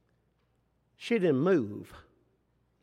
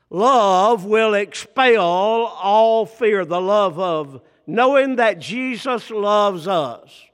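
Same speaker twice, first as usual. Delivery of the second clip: slow louder preaching; 5 dB louder, slower than usual. Acted. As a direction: draw out each word loudly, proclaiming. Preaching climax.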